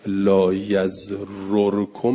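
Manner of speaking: لا یزررکم said very slowly, rising at the end; slow Arabic recitation of لا یزررکم; slow demo with damma. Shown as a demonstration. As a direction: drag out لا یزررکم with a final damma. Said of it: "فضمت الثانیه".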